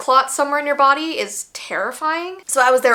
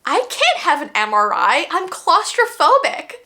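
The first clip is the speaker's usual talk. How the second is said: panicked protest; 6 semitones higher than usual. Retold or imitated. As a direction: beg off the scan at the last moment. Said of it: "this lady's like".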